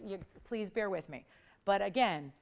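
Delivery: ordinary talk, nothing marked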